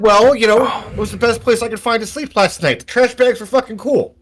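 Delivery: put-on high-pitched, defensive kid; street kid. Kid defending sleeping in the trash, high-pitched. Game master voices the garbage-pile kid.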